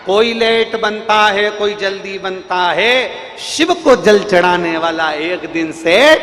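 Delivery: loud, slow rhythmic chanting; rousing chant for a crowd to answer; slogan call. Real, not acted. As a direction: call each line out, stretching the last word. Leads a call-and-response slogan.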